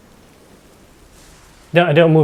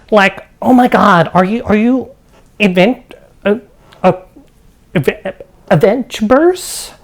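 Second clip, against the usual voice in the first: lightly voiced, higher-pitched excited woman; a hint of a flustered character, pitched up; erratic speech; 5 semitones higher than usual. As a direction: stammer and restart, reaching for the word. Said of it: "Her movements are erratic, and her speech".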